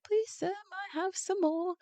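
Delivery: put-on a hungry little boy begging travellers, high and pleading. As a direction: beg like a small hungry boy. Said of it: "the little boy's like".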